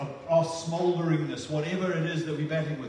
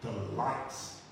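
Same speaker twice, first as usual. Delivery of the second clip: God's tender voice; 5 semitones lower, 4 dB quieter than usual. Acted. Pastor voicing God's delight in Jesus, tender and hushed. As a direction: murmur it like God delighting in his son. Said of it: "This is what God says about Jesus".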